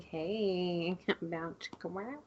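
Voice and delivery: soft, breathy little aside with a laugh; hushed quote. Retold or imitated. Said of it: "maybe that was her being like".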